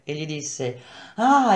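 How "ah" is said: high-pitched "ah" of realisation; bright little jump of discovery; exclamation. A narrator's reading. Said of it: "ella esclamò".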